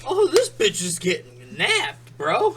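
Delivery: high, loud, slow dopey bro; mocking bro voice. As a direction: play a clueless bro. Mocking bystander.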